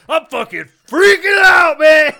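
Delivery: high, slow, loud manic scream; maniac old man shout. Pretend. Old man answers a stranger, screaming high and slow. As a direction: snap from banker to maniac. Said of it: "Oh, that's very good".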